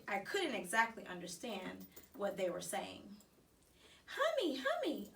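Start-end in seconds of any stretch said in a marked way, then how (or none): 4.0-5.1 put-on high little toddler call. A narrator's reading. Little brother calling the hamster by name, high toddler voice. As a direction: call out like a tiny child. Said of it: "a little voice called out".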